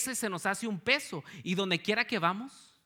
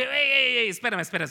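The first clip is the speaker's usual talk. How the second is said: loud, higher "wait, wait!"; surprised call; 5 semitones higher, 9 dB louder than usual. Retold or imitated. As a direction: call out to stop him mid-sentence. Stopping Manuel in surprise.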